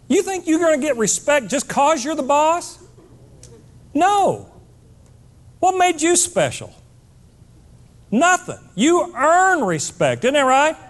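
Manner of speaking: high-pitched, punchy rhetorical takedown; worked-up scolding rant; indignant challenge. Acted. Mock challenge to self-important bosses.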